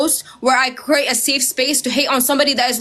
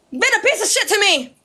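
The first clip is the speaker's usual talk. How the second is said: normal talking voice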